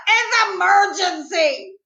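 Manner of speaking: high, stretched-out shout; urgent shout. A narrator's reading. Child needing the potty, shouting. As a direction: shout it slowly, pitched well up. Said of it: "I shouted".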